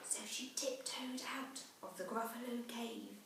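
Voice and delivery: breathy secret whisper as she sneaks out; hushed whisper. A narrator's reading. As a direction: whisper it like tiptoeing. Narrating the child sneaking out.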